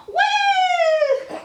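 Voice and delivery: very high whoop of delight; excited cheer. Real, not acted. Cheering baby's jump.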